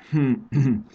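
pointed little throat clear; Fanny clearing her throat at the boasting boy. A narrator's reading. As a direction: clear your throat to get his attention. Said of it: "köhécselt Fanny".

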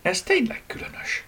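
high, wondering voice; pondering. Acted. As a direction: muse over it, puzzled. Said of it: "töprengett Eleonóra".

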